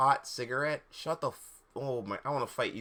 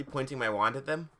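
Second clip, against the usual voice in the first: ordinary delivery, nothing marked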